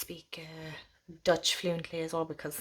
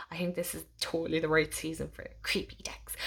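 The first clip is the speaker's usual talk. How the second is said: hushed, voiceless aside about creepy decks; conspiratorial whisper; spooky whisper; 2 semitones lower, 5 dB quieter than usual. Acted. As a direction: whisper it like a ghost story.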